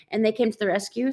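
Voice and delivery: ordinary delivery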